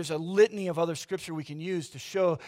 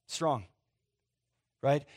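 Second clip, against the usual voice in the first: usual speaking voice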